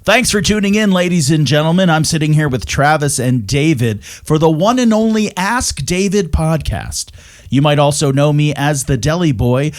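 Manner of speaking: booming wrestling announcer intro. Shown as a demonstration. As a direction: hype up the crowd like a ring announcer. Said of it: "give us your announcer voice from the W C W".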